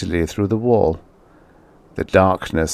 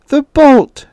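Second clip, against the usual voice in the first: put-on very high, loud shout of a straining old woman; loud cry; 8 dB louder than usual. A narrator's reading. Old woman calling to her husband for help, loud cry. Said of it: "she cried loudly".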